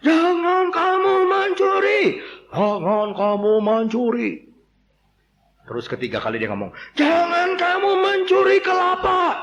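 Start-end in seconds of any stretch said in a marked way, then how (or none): 0.1-4.5 high, slow, loud command; loud preaching. Acted. Preacher thunders the eighth commandment at the crowd. 6.9-9.3 high, slow, loud pronouncement; loud command. Acted. Preacher thunders against stealing coconuts.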